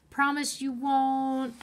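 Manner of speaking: slow, drawn-out plea; slow, odd-voiced last plea; trailing off. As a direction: let it trail slowly. Jack's final trailing demand.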